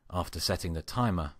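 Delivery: plain reading voice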